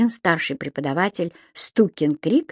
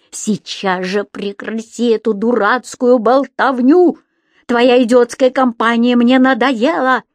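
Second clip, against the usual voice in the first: exasperated shout, building louder and higher; 3 semitones higher, 5 dB louder than usual. A narrator's reading. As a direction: yell that you are fed up. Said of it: "закричал старший преподаватель".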